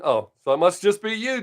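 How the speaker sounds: normal talking voice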